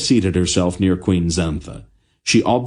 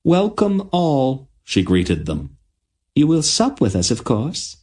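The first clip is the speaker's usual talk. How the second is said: dryad queen greeting; 8 semitones higher than usual. A narrator's reading. The queen inviting the travellers to supper, high and regal. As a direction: welcome guests graciously.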